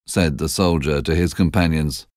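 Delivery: ordinary delivery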